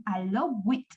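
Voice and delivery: "allow with" with a false syllable on allowed; wrong added syllable. Shown as a demonstration. Teacher mocks up the wrong form, extra syllable at the end. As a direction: turn allowed mistakenly into "allow with". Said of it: "No suena ningún".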